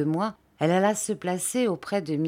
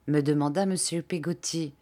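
ordinary delivery, nothing marked

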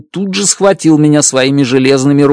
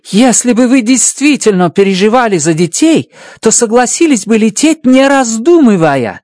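higher, firm woman's voice pressing him; a determined woman pressing the point; Stratt's voice, pushing; 11 semitones higher than usual. Acted. Stratt presses Grace to fly, firmly.

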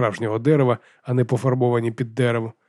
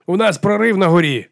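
urgent radio report; 7 semitones higher, 4 dB louder than usual. A narrator's reading. Батько доповідає про прорив.

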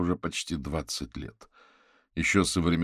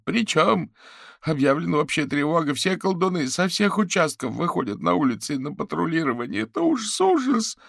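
high, loud, frantic lament; panicked caller; 12 semitones higher, 7 dB louder than usual. Acted. Fetisov reports the citywide alarm to Averin, horrified.

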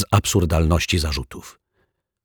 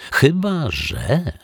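slow suspense; 9 semitones higher, much slower than usual. A narrator's reading. Suspense in narration.

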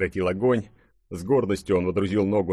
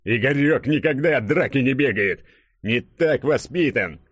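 higher, emphatic boasting, slowing at the end; proud burly hero, defiant; 5 semitones higher, 4 dB louder than usual. Acted. Hero refusing to flee from a fight, proud defiant declaration. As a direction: declare it like a stubborn strongman.

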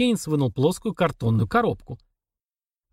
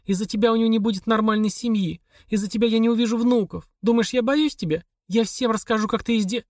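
a hint of a higher, pleading wife's voice; lightly voiced wife Olga; 8 semitones higher than usual. A narrator's reading. Wife demanding a divorce from her husband, lightly voiced. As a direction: read her lines a little higher, worn out and insistent. Olga's voice in the dialogue.

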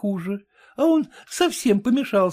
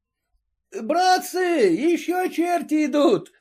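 крик из толпы; 9 semitones higher, slower than usual. A narrator's reading. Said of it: "послышалось на улице".

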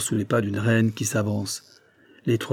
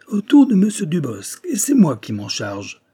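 loud, higher start, settling down; gleeful shout; 7 semitones higher, 5 dB louder than usual. A narrator's reading. Despot announces he will kill Dubosc himself, gleeful shout. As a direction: claim the kill yourself, loud and pleased. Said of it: "s'écria le despote".

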